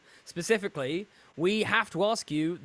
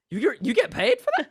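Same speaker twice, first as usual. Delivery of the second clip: incredulous; 12 semitones higher, 6 dB louder than usual. Pretend. Mock disbelief at paid podcasting.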